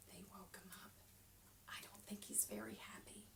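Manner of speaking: soft unvoiced whisper. A narrator's reading. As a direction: lean in and whisper. Whispered aside to children.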